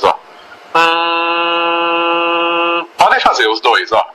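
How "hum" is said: steady closed-lip resonance hum; humming with closed lips. Shown as a demonstration. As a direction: lips shut, let the hum buzz inside. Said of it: "Esse aqui, ó, escuta uma vez". (zumbido de boca fechada)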